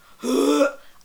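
loud sharp gasp; dramatic shocked gasp. Real, not acted. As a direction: gasp in horror. Shocked by story.

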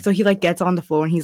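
usual speaking voice

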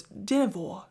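soft British-style Dynevor with the final R dropped; badly faked British accent; attempted British accent. Shown as a demonstration. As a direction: try saying Dynevor the British way, quietly. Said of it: "say it in a British accent".

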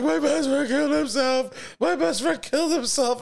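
very high imitated wail; mock hysterical crying. Bandmate freaking out about his best friend, hammed-up sobbing wail. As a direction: wail it like a friend falling apart. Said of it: "he starts hysterically crying".

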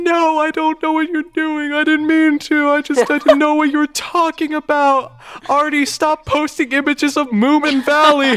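very high, loud, slow wail; hammed-up childish pleading, breaking into laughter; mock panicked kid. Acting panicked child self, then scolding Artie.

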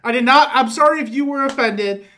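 fake apology. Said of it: "pre-apologize for getting canceled".

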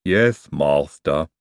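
low, very slow lisping "Yes, master"; gloomy, dragged-out obedience. Acted. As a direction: answer as a dejected lisping servant. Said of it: "said Igor gloomily".

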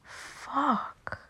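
a breathless whispered fuck; whispered curse. Real, not acted. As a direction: whisper it in frustration. Can't think of anyone.

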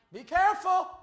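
loud, heavy warning from the pulpit; stern preached warning. Acted. Pastor warning judgmental listeners, loud stern preaching. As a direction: hammer the caution home.

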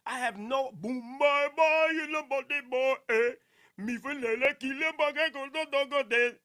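hammed-up mock Jamaican patois, belted; Jamaican patois imitation. Streamer imitating a Jamaican song he can't understand, mock Jamaican patois. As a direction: belt out garbled Jamaican patois lyrics. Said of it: "them Jamaicans be saying".

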